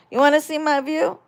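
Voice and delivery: normal talking voice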